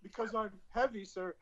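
soft, timid recruit answer; meek recruit. Retold or imitated. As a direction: mumble it like a scared private.

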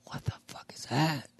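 high, hushed whisper of disbelief; a startled Marine whispering from his sleeping bag; scared whisper. Retold or imitated. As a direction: breathe it out, spooked awake. Retold waking scared in sleeping bag.

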